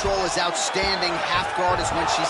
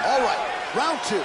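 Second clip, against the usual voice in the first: big announcer call, higher pitch; booming fighting game announcer; 6 semitones higher than usual. Real, not acted. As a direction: announce the round to the crowd. Announcing the next round.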